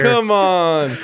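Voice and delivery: high, loud overwhelmed protest; emotional outcry. Real, not acted. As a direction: cry out, moved beyond words. Players overwhelmed by sad note.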